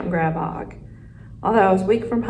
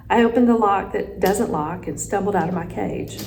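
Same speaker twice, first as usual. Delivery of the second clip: ordinary delivery, nothing marked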